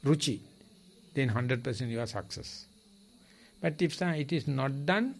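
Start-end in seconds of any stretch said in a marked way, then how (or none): none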